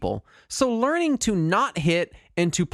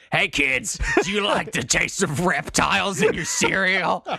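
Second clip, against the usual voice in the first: cereal mascot. Acted. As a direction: shout it like a cartoon mascot on a breakfast ad. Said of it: "a cereal mascot".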